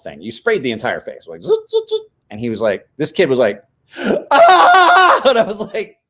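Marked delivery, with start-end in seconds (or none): 1.4-2.0 spray hiss noise. Retold or imitated. Imitating spray can noise. 4.3-5.2 pained scream. Retold or imitated. Kid screaming from pepper spray.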